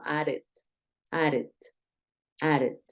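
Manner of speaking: "added" said as "ared", low; D sounded like R. Shown as a demonstration. Teacher drills the sound with learners, "added" with D as R. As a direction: tap the DD in "added" like a Spanish R. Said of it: "un sonido como de R".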